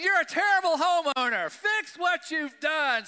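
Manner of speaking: high, loud, slow mocking shout. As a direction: shout it as the bossy letter in his head. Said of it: "I just start getting mad".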